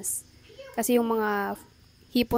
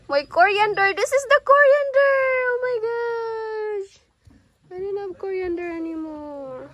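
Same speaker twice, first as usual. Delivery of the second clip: much higher, hammed-up wail over the coriander; mock lament; 10 semitones higher than usual. Mother to son over lost coriander, hammed-up lament.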